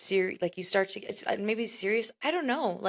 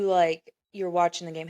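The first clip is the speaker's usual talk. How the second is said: ordinary delivery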